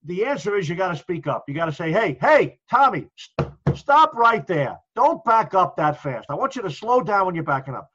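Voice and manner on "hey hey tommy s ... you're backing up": firm, raised voice with drawn-out commands; assertive call-out. Shown as a demonstration. Officer correcting a firefighter backing up too fast, assertively. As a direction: speak up and stop him right there. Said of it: "I consider it being a little assertive".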